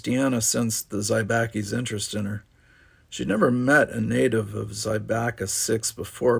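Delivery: ordinary delivery, nothing marked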